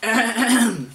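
theatrical throat clear before speaking; pompous throat-clearing. Acted. Chief frog about to address the visitor, clearing throat. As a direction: clear your throat to claim attention.

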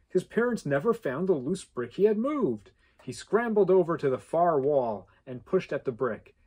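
ordinary delivery, nothing marked

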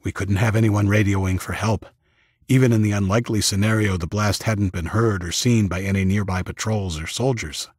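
plain reading voice

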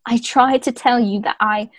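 mock Leeds accent, laughing through it; broad Leeds vowels, hammed-up; put-on Leeds accent. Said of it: "zoomed into, like, a Leeds accent".

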